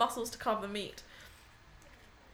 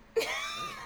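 high squealing laugh. Real, not acted. Laughing at own voice.

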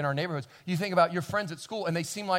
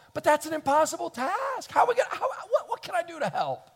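high, pleading, half-laughing despair; overwhelmed plea; 11 semitones higher than usual. Retold or imitated. Compassionate believer overwhelmed by hurting people, pleading. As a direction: throw up your hands at the task. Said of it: "You go".

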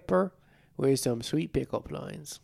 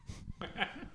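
a quick, very high, soft giggle; 16 dB quieter than usual. Acted. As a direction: squeak out a tiny laugh. Laugh during fake ad.

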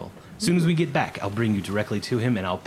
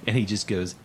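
ordinary talk

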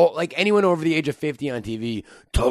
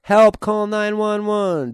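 high, slow, louder cry for help; mock panic call; 8 semitones higher, 4 dB louder, slower than usual. Acting out a YouTube sound clip, hammed-up high panic.

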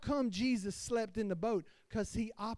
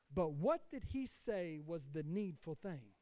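ordinary talk

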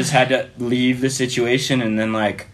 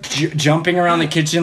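normal talking voice

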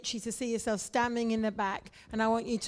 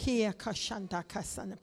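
praying in tongues; 2 semitones lower, 5 dB quieter than usual. Real, not acted. Pastor praying in tongues softly.

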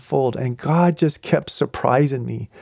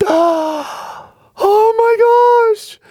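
high, slow, louder squeal of delight; starstruck squeal; 5 dB louder, much slower than usual. Retold or imitated. Elderly fan recognising Mark, squealing with excitement. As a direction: squeal like meeting your idol. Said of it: "And she does this".

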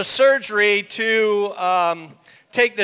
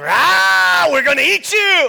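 high, loud lion roar into a threat; full-voiced menacing shout; 12 semitones higher, 8 dB louder than usual. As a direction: roar it out like hungry lions. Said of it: "those lions are going".

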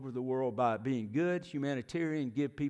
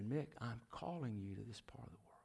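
the Spirit's words, breathed in an unvoiced whisper; 5 semitones lower, 14 dB quieter than usual. The Holy Spirit calling Mick to Russia, in a hushed whisper. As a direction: let it drop to a bare whisper as if heard inside.

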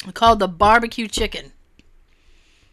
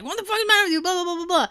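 high-pitched mock scolding; mock rant trailing into blahs; Gordon; 7 semitones higher, 3 dB quieter, much faster than usual. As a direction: imitate an angry chef telling someone off. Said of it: "Gordon comes over".

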